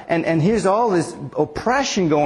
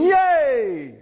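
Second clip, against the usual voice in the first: high, stretched-out yay; imagined cheer; 9 semitones higher than usual. Acted. Pastor acting out relieved onlookers, high drawn-out cheer. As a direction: let the cheer ring high, then fall away. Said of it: "you'd be saying".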